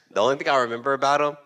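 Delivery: ordinary talk, nothing marked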